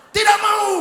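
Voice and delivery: high loud indignant no; startled refusal. Acted. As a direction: refuse him in shock. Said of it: "kaget dan berkata".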